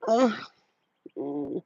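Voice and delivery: mock bedroom moans trailing off; mock moaning woman. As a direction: ham up a bored lover faking it. Mocking boring women in bed.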